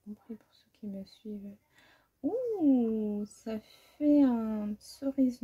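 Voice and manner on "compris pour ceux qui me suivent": confiding whisper to followers; whispered wink to regular viewers; whispered aside. Real, not acted. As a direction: drop to a voiceless whisper as a joke. Self-mocking aside to followers.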